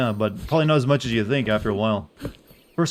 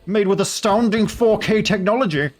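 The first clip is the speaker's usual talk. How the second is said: high, loud hyped ad voice; graphics hog voice; 9 semitones higher, 4 dB louder than usual. Pretend. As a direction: hype it like a tech commercial. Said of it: "yo, hog voice".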